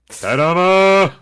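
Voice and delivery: high, loud, drawn-out flourish; showy fanfare. Retold or imitated. Popping into a friends' call unannounced, loud showy fanfare. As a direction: burst in with a big ta-da. Announcing himself on popping into calls.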